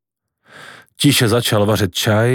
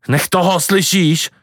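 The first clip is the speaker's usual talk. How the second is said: shrieking; 6 semitones higher than usual. A narrator's reading. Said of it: "zaječela babička tak nahlas".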